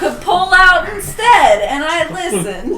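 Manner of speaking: high, loud mock accusation; hammed-up complaint through laughter; aggrieved wife. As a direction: play a wife blaming her husband. Acted quarrel as pregnant wife.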